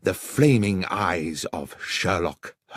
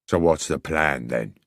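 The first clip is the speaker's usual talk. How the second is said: lightly voiced gruff villain; a touch of a low, gruff crook; 5 semitones lower than usual. A narrator's reading. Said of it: "asked the gruff voice within".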